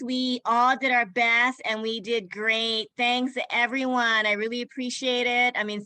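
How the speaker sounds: ordinary talk, nothing marked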